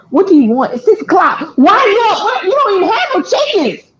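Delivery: retold rant, building in disbelief; exasperated complaint. Woken grandchild asking why she calls at six, exasperated complaint. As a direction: pitch climbing into high protest.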